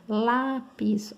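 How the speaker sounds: lápis with a long, weighted LÁ; stress on penultimate syllable. Shown as a demonstration. Teacher showing why it is a paroxytone, lápis stressed on LÁ. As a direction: lean hard on LÁ in lápis. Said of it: "ó, penúltima".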